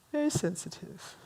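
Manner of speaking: very soft, dropped-away repeat; hushed aside. Real, not acted. Speaker confides about romantics being hurt, hushed.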